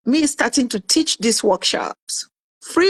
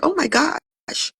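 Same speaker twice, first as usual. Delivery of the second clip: dismayed gasp; 6 semitones higher, 3 dB quieter, slower than usual. Retold or imitated. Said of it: "I'm like".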